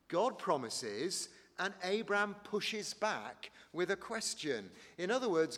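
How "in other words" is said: ordinary delivery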